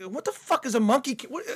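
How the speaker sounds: high-pitched, bewildered question; baffled reaction. Retold or imitated. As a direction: act out being utterly baffled. Retelling his baffled reaction.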